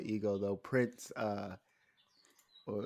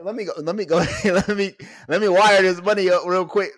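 mock eager victim; 8 semitones higher, 10 dB louder than usual. Acting out the eager scam victim.

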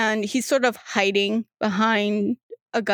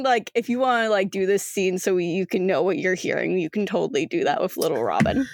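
ordinary talk

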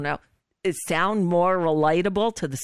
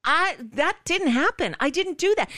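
mild mock protest, pitched up; a light imitation of Garland denying it; imitating Garland; 7 semitones higher than usual. Host mimics Garland rebutting the senator, mildly raised protest. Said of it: "And Merrick Garland's going".

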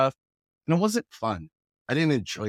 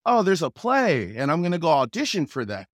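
eager announcement; 5 semitones higher, 5 dB louder than usual. Retold or imitated. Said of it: "I was like".

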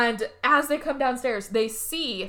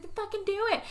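high, quieter acted challenge; mock dare; 10 semitones higher, 5 dB quieter, much faster than usual. Girl wrestling her rival, mock defiant dare. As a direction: dare them in a raised pitch.

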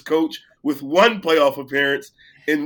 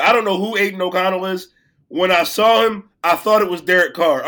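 normal talking voice

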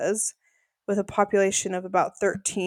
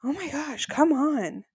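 high-pitched mock exasperation; exasperated teammate; 7 semitones higher, slower than usual. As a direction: imitate a fed-up partner.

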